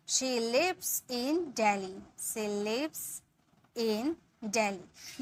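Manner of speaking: slow, clear dictation; slow model sentence. Shown as a demonstration. Teacher dictating the translation slowly. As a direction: stretch it for students to follow. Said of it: "इसके लिए बोलेंगे".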